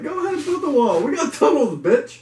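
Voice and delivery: cocky quote. Retold or imitated. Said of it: "And he was like".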